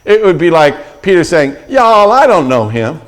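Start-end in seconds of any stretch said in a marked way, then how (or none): 1.7-3.0 put-on Southern accent. Peter given a light Southern accent, denying Jesus. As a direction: lightly play a Southern denier. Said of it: "his accent".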